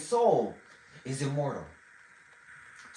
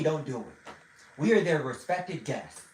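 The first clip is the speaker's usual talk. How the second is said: hushed warning; 5 semitones lower, 7 dB quieter than usual. Retold or imitated. Wife hushing him at dinner.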